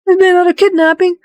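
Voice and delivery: high, fast, startled yelp of a question; shocked disbelief. Retold or imitated. A party member hearing of another kidnapping, shocked and high. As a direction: reel at the news, voice leaping up. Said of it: "It's like".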